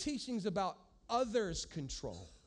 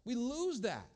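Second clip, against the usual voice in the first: usual speaking voice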